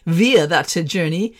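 "via" said as VEE-uh, slowly; "via" with an EE sound, American style. Shown as a demonstration. Podcaster mimicking Americans saying "via". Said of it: "or as Americans would say".

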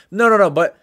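ordinary talk, nothing marked

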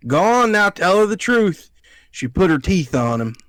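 high, loud imitation of a Southern aunt; fake Southern accent. Nephew mimicking his dramatic Alabama aunt, fake Southern accent. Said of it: "puts on a fake accent".

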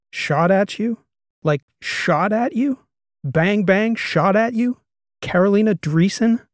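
can't get past the words shot at you; stunned disbelief. A narrator's reading. Karsten hearing someone shot at Karolina, stunned. Learns she was shot at.

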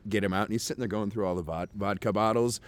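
ordinary delivery

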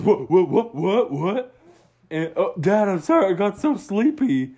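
jolted awake and apologizing; startled sleepy otter. Acted. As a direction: Otto waking with a start, sheepish.

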